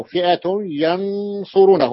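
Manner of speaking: فئة ينصرونه slow, nun merged into ya; فئة ينصرونه drawn out, nun gone into ya; slow recited example. Shown as a demonstration. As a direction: read فئة ينصرونه slowly with the nasal merge. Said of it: "وهذه الياء".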